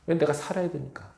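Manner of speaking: ordinary talk, nothing marked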